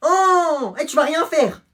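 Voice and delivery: voix de gamin, très aiguë. Acted. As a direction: nargue en criant. (gamin qui nargue pendant la bagarre)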